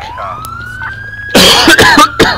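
real hacking cough; coughing fit. Real, not acted. Player interrupted mid-game, coughing fit. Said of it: "I can't stop coughing".

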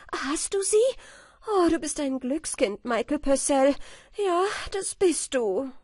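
high, loud, delighted wife's voice; wife exclaiming. A narrator's reading. Wife praising her lucky husband, exclaiming. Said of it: "rief die Frau".

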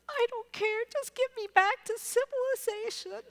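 high, pleading and exasperated; desperate plea. Retold or imitated. The lost teacher answers the driver's ed teacher, high and desperate. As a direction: beg to be rescued, near tears.

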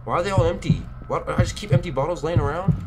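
high panicked disbelief; scared horror game streamer. Real, not acted. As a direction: wail it out in frustration.

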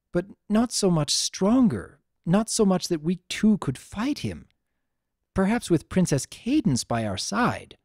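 put-on a troubled princess recalling an old defeat; Princess Luna, worried. A narrator's reading.

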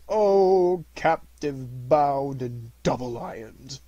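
long drawn-out, loud wail; anguished cry. A narrator's reading. Phantom cries out to Scrooge, wailing loud and slow. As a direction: cry it out slowly, stretching the words. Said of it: "cried the phantom".

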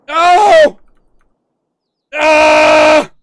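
high, very loud wordless cries; dismayed yells. Real, not acted. Hunter reacts to a botch, screaming.

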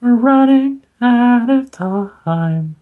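slow, high, loud dramatic repeat; dramatic high echo. Pretend. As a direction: wail it out like a melodrama. Echoed dramatically.

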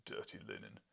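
low rumbling growl; rumbled. Acted. Husband to wife, a low rumbling growl. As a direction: rumble it deep in the chest. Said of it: "he rumbled".

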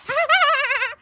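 loud, very high mocking laugh; imp's cackle. A narrator's reading. Peeves after tricking Filch, shrieking gleeful cackle. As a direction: cackle in triumph.

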